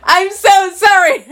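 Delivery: laughing so hard it nearly turns to crying; helpless giggles. Real, not acted. Laughing at her own mistake.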